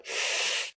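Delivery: long unvoiced sigh; imitating a friend's tired sigh before he answers. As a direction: let out a weary breath. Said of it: "But just went".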